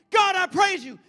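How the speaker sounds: high, shouted praise; exuberant group. Acted. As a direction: shout it out to God. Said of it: "Then you got another one".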